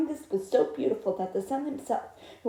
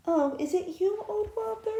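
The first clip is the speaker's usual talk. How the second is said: princess voice; 9 semitones higher than usual. Acted. Princess answering the frog.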